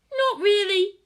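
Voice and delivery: put-on very high, eager child's voice; squeaky wide-eyed child; excited little girl. A narrator's reading. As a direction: ask like an awed kid.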